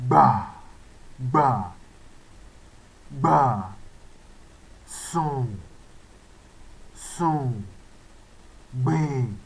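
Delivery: "gbà", "sùn", "gbìn" said low and falling; low tone words. Shown as a demonstration. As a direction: keep every word down on the low tone. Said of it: "That is do".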